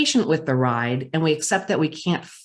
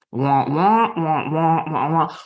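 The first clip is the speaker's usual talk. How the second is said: a muffled trombone-like wah wah wah, cartoon teacher style; mock wah-wah droning; 2 semitones lower than usual. Tuning out the pacing CEO, mock wah-wah droning. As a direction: drone the boss's words into meaningless wahs. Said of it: "he starts talking and I hear wah".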